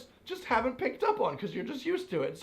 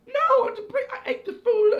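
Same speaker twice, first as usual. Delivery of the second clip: very high, loud mock outburst; hammed-up indignant yelp; exasperated protest; 7 dB louder than usual. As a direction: squeal your objection to the waiter. Acted protest to baffled waiter.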